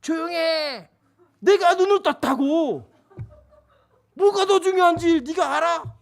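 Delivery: high, loud mock outburst; angry retort. Myeongsu yells at sister, hammed-up angry shout. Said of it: "명수 뭐라 그러겠어요?".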